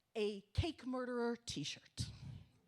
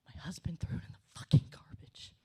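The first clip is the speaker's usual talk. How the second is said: conspiratorial whisper; 4 semitones lower, 4 dB louder than usual. Retold or imitated. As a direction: let them in on the scandal in a whisper. Telling the food group the story.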